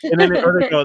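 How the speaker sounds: ordinary delivery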